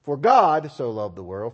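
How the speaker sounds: "God" punched loud, the rest of the verse lighter; heavy emphasis on "God" alone; stress on God. Shown as a demonstration. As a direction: lean hard on the word "God". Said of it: "you can stress it differently".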